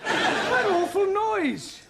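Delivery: high, slow and appalled. Acted. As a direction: recoil at the racket. Alarmed at awful noise.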